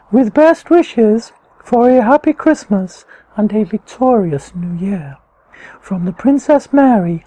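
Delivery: high, slow, posh royal voice; best Princess Mary voice. Pretend. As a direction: play a grand princess reading her card. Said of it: "I'm gonna say it in my best Princess Mary voice".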